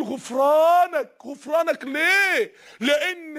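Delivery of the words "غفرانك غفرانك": slow prayerful plea. Retold or imitated. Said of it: "كان يقول".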